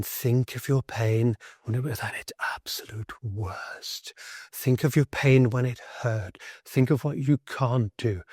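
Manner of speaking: slow, hushed therapist voice; very calm. Retold or imitated. Therapist telling a patient to think of his pain, very calm. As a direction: talk them slowly and calmly into it. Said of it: "talking very calmly and saying".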